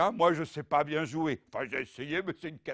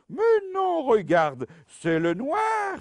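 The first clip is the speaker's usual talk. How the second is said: loud, high mock disbelief; mock astonished; 8 semitones higher, 7 dB louder, slower than usual. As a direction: act stunned and loud.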